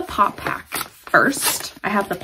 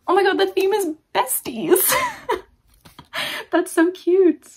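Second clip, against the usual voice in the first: gushing; 9 semitones higher than usual. Real, not acted. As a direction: coo at something adorable. Gushing over besties theme.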